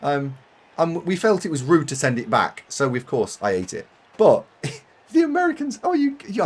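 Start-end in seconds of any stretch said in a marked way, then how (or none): 5.1-6.4 laughing gush. Real, not acted. Gushing about Americans, laughing.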